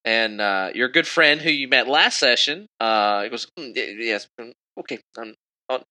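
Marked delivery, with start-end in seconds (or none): 3.6-5.8 soft, halting mumbles; tiny unsure voice, breaking off between words; nervous fumbling. Acted. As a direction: stammer quietly like a flustered barkeep. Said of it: "He's a little nervous".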